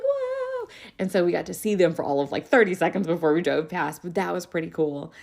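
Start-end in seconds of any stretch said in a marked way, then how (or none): 0.0-0.7 high, wowed exclamation; a big impressed whoa; amazed whoa. Retold or imitated. Teacher and kids react to the skateboarder's drop, high and amazed.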